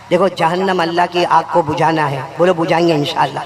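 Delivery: ordinary delivery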